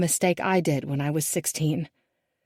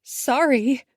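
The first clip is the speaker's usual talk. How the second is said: high, louder sob; sobbing; 7 semitones higher, 4 dB louder than usual. A narrator's reading. Daughter sobbing at her father. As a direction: choke out sorry through a sob. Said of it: "Isabel sobs".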